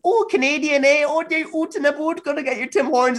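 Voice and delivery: very high mock Canadian accent; fake Canadian accent. American teasing a Canadian at a hostel, fake Canadian accent. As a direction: mimic an American badly faking a Canadian accent. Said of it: "anything in a fake Canadian accent".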